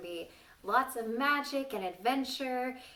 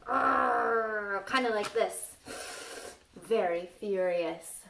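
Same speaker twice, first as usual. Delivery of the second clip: slow, louder furious voice; furious demo; 4 dB louder, much slower than usual. Shown as a demonstration. Teacher demonstrating a furious face to children, slow angry voice. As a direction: show them a furious face in your voice. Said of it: "Like you're really angry".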